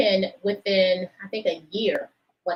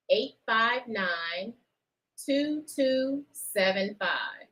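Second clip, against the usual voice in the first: slow digits; slower than usual. Shown as a demonstration. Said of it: "You got it?".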